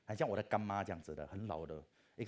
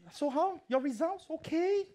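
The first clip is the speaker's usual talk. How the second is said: much higher, louder put-on old lady voice; lecturer's question; 9 dB louder, much slower than usual. Elderly lecturer eagerly asks student about results, high eager voice.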